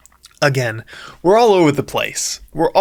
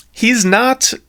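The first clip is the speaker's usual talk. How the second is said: plain reading voice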